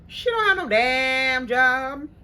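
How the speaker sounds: loud, slow, emphatic indignation; indignant rant. Real, not acted. Complaining about the lazy roommate, loud slow outrage. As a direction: hammer each word with outrage.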